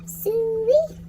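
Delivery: loud, high mock hog call. Calling pigs to bring them bacon, a loud mock hog call.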